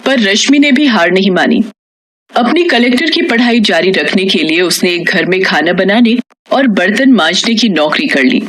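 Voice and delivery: plain reading voice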